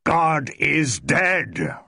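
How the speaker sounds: slow, loud, booming pronouncement; a slow, loud proclamation; thundering. A narrator's reading. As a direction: thunder it down at her. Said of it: "Roman thundered".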